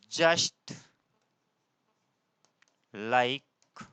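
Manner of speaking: very slow, word by word with long pauses; measured, halting delivery for note-taking; dictation. Real, not acted. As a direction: dictate slowly while writing it out. Dictating the sentence while writing it.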